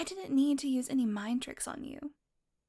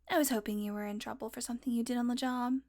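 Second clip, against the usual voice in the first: normal talking voice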